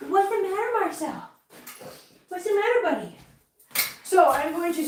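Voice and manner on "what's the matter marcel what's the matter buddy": pet talk. Real, not acted. Owner asking her upset cat what's wrong, high coaxing. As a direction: fuss over the cat tenderly.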